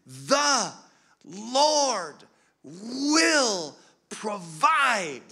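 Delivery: loud, high, very drawn-out words, imitating mother; imitated emphatic insistence. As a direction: hammer out each word slowly and forcefully. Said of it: "stamped her foot and gripped my hands even harder and said it again".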